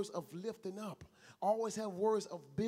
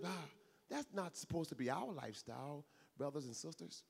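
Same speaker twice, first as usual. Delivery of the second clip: ordinary delivery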